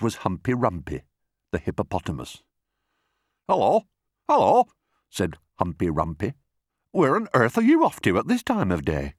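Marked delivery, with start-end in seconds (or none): 3.5-4.7 high, loud double greeting; booming, swooping hippo hail; jovial hippopotamus. Acted. Hippo greets the passing crocodile. 6.9-9.1 high, surprised question; lively hippo voice, high at the start; inquisitive hippopotamus. Acted.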